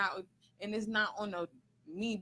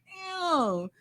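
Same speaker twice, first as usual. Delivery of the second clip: surprised; 8 semitones higher, 6 dB louder than usual. Retold or imitated. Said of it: "I'm just like".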